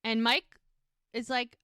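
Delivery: plain reading voice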